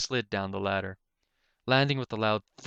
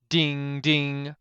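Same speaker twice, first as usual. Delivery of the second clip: clanging bolt; 5 semitones higher, 7 dB louder than usual. Acted.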